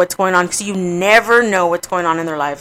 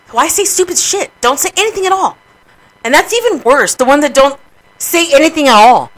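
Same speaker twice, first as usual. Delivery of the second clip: higher-pitched, emphatic exasperation; exasperated rant; 6 semitones higher, 4 dB louder than usual. Real, not acted. Griever fed up with thoughtless remarks, exasperated. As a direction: plead angrily, pitch pushed up.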